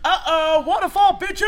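excited call. Real, not acted. Calling out the game.

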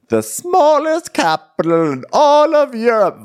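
high, slow, far-off put-on voice; hammed-up tour guide; mock grandeur. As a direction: announce it like a pompous guide.

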